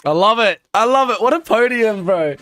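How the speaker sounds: delighted outburst; thrilled. Real, not acted. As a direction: cheer the podium. Excited podium celebration.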